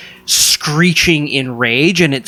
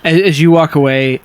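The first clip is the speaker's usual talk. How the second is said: ordinary talk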